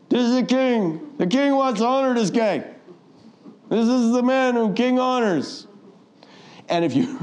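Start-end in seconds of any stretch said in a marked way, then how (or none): 0.1-5.6 grudging herald cry. Retold or imitated. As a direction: announce it through clenched teeth. Haman forced to proclaim Mordecai.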